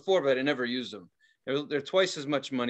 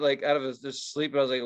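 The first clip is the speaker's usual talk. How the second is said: usual speaking voice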